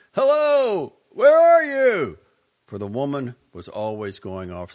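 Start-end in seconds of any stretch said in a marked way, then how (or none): 0.1-2.2 high, loud, slow petulant call. A narrator's reading. Husband calling pettishly to his wife, high loud. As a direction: whine it out across the house. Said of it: "he would call pettishly".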